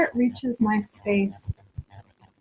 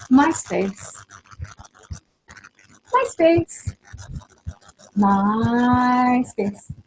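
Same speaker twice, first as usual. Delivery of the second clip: light, teasing sing-song; playful; much slower than usual. Shown as a demonstration. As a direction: say it like a game, not a fight. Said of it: "you can say it playfully".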